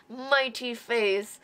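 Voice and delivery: ordinary talk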